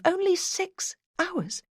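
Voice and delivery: disbelief at how little time is left; incredulous thought. A narrator's reading. Detective hearing the judge's short extension, incredulous. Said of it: "I thought to myself".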